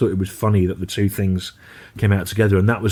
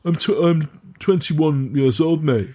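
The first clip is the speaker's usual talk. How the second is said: posh youth putting on street talk; slow, higher mock-posh lad; posh street persona; 6 semitones higher, slower than usual. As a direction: imitate a posh student playing streetwise. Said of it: "in a posh voice and".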